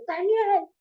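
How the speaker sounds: calling out. Retold or imitated. King calls into lions' den.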